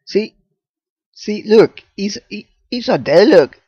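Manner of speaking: high, loud excited calls; excited shouting. Real, not acted. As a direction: point and shout in delight. Excited about floating character.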